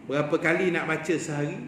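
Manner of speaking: ordinary delivery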